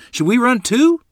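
baffled, high and loud; unsure, pitch leaping upward; puzzled question. A narrator's reading. Child asks whether to run along, puzzled. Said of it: "I asked in puzzlement".

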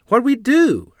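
high, loud frightened question; child's high, worried cry; anxious child. A narrator's reading. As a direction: blurt it out, scared. Frightened child asks mother.

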